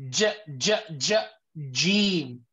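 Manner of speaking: j 소리를 끊어 반복한 뒤 Jim; demonstrated Jim. Shown as a demonstration. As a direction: break j apart, then say Jim. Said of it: "So we will say Jim".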